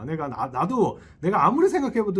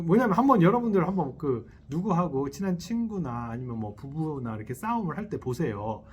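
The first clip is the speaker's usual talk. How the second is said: usual speaking voice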